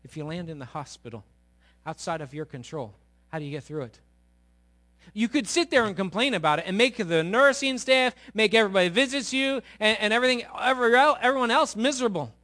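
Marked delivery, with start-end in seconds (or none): 5.1-12.3 loud, high, driving sermon voice; full-force preaching. Acted. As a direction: preach it out to the congregation.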